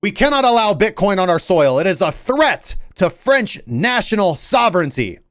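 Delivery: high, loud mock proclamation; French minister proclaiming. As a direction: declaim it like an outraged official at a podium. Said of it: "get up there and say".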